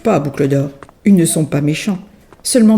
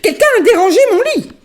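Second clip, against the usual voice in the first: very high, fast, hammed-up anger; mama bear getting worked up; angrier mama bear; much faster than usual. A narrator's reading. Mama bear finds her bed disturbed, fast and cross. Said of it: "de plus en plus fâchée, s'énerve".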